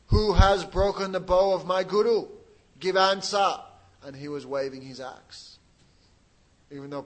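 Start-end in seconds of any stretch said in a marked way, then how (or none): 0.1-3.6 loud, slow, much louder furious roar; furious demand. Acted. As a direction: bellow it at the kings, demanding an answer. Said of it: "shaking with anger".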